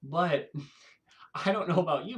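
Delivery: ordinary talk, nothing marked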